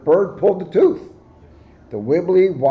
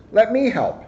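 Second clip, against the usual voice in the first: lightly voiced zebra, pitched up; chirpy offer of help; high zebra voice; 8 semitones higher than usual. Zebra voiced in picture book.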